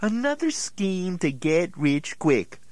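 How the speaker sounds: ordinary talk, nothing marked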